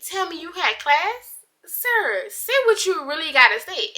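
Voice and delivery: sassy disbelief. Retold or imitated. Confronting him over fake alarm.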